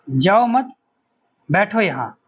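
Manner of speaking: "जाओ मत" साथ में, फिर रुककर "बैठो यहाँ"; मत के बाद विराम. Shown as a demonstration. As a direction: pause after मत, before बैठो. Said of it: "एक कोमा का अंतर है". ("मत" को "जाओ" से जोड़कर बोलें, फिर ठहरें)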